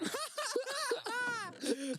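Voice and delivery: very high, wheezy peal of laughter; helpless laugh. Real, not acted. Laughing at a joke made to defuse tension, helpless laugh. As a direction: laugh long and high, nearly crying.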